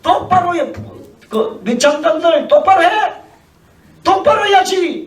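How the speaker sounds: hammed-up royal scolding; a king half-laughing while he scolds. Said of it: "막 야단을 치는 겁니다".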